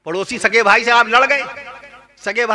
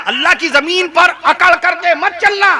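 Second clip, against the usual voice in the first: raised preaching voice; 6 semitones higher than usual. Shown as a demonstration. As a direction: proclaim it from the pulpit. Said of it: "repeated softly after".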